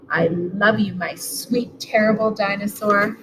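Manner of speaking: ordinary delivery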